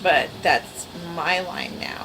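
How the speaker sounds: ordinary talk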